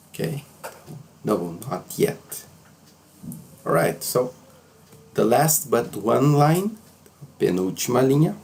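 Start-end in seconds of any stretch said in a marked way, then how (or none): none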